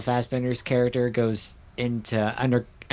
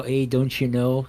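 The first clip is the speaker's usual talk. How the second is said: put-on slow Minnesota Northern accent. Streamer giving an example of the Minnesota accent, stretched out. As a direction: imitate a Minnesotan, vowels drawn out. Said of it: "the Minnesota Northern".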